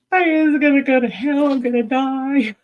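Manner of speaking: high, loud, panicked wail; retold panic. Recalling her first meditation, panicking to her guides, high loud wail. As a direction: replay the terror of seeing hell's flames. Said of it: "I was tr-, pretty traumatized".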